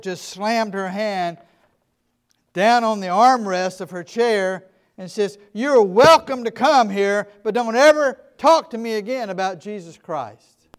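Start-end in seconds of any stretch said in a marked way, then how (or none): 5.5-10.7 angry rebuff. Retold or imitated. Old woman shutting down the pastor, loud and angry. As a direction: bite off each word like you mean it.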